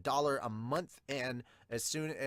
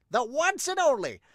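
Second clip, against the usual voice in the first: much higher and louder echo; rising dramatic shout; 12 semitones higher, 8 dB louder than usual. Acted. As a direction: repeat it as a rallying cry.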